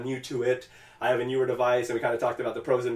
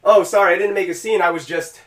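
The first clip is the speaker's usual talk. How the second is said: raised pitch, loud start then easing; flustered apology; 5 semitones higher, 7 dB louder than usual. Retold or imitated. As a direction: imitate someone hastily excusing himself to police.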